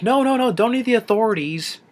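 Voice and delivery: high, frantic alien protest; lightly voiced nervous alien; Pleakley voice. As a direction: wave off the police in a squeaky panic. Retold Pleakley line.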